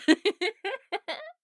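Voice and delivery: bright laugh, high and loud; delighted laugh. Real, not acted. Laughing at the pilot's reddening face, a high loud laugh. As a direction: burst out laughing at his blush. Teasing the listener's red face.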